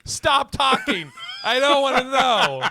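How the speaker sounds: loud, high pleading shout; mock panic. The boyfriend hamming up a plea to not hear her number, loud and high. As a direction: beg her to stop, hammed-up. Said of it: "I would be like".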